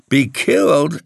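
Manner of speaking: high-pitched incredulous question; startled echo. A narrator's reading. Tenant repeating the caretaker's words, startled. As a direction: echo it back, pitch jumping up. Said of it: "I repeated".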